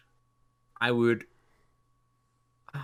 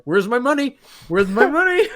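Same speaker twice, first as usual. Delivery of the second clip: high, loud laughing demand; gruff impatient scolding; 11 semitones higher, 9 dB louder than usual. Pretend. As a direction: yell it like a greedy landlord.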